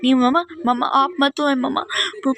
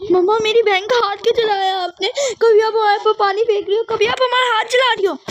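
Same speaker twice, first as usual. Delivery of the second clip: tearful protest; 7 semitones higher than usual. Acted. Brother crying at mother for burning his sister. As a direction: plead through tears.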